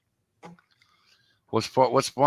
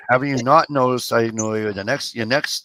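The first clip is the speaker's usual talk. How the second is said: ordinary talk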